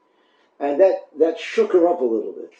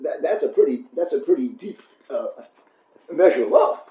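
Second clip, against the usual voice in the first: normal talking voice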